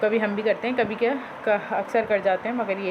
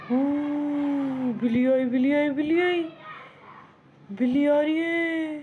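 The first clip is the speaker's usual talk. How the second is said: teasing warning; 5 semitones higher than usual. Pretend. Teasing the parrot about a cat.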